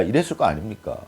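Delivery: usual speaking voice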